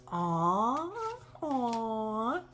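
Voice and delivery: drawn-out, rising "aw"; a put-on cute-reaction coo. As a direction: coo "aw" high and slow, as at something adorable. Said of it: "I wouldn't say it's".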